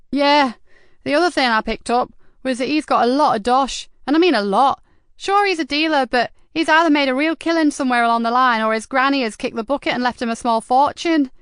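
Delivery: put-on a cocky teen sharing hot gossip; teenage informant.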